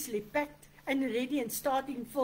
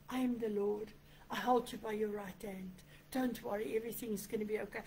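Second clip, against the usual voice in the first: softer, settled comforting voice; gentle reassurance; 3 semitones lower, 6 dB quieter than usual. Acted. Holy Spirit speaking into your ear, reassuring. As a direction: soothe a worried listener quietly.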